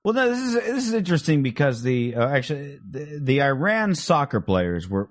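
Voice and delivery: normal talking voice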